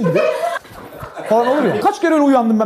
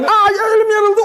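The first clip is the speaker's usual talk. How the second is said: higher, louder startled ouch; mock yelp of pain; 9 semitones higher, 4 dB louder, slower than usual. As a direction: yelp as if you just cut your hand. Elini kesen misafir. (elini kesmiş gibi tiz çığlık)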